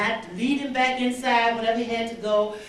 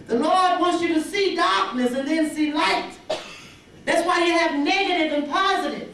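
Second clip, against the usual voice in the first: higher, raised preaching voice; 6 semitones higher than usual. Acted. Speaker exhorting the audience about problems, preaching. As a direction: lift it like a sermon to the room.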